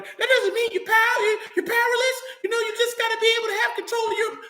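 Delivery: lampoon the women's advice in a shrill, sing-song falsetto; mocking women's voice. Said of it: "that's where the women come in".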